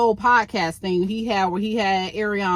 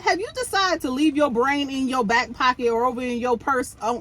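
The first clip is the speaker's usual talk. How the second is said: ordinary talk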